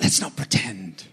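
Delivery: low, soft, kind correction; gently truthful. Retold or imitated. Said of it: "He just gently states the truth".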